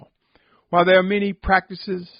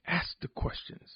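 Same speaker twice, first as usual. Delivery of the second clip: whispered urging; 11 dB quieter than usual. Acted. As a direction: drop to a breathy whisper for the plea. Sermon urging listeners, sudden hush.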